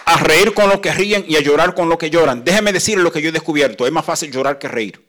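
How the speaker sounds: loud, ringing pulpit voice; loud impassioned preaching; preaching at full force. Real, not acted. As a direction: proclaim it to the congregation.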